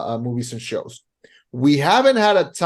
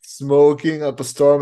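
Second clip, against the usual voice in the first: normal talking voice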